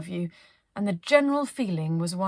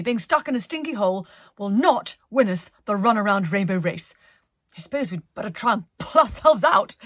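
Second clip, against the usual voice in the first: rallying captain; 8 dB louder than usual. Acted. Penelope rallies crew.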